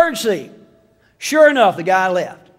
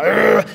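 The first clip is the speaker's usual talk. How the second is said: bumblebee buzz. Retold or imitated. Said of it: "sounded like a bumblebee".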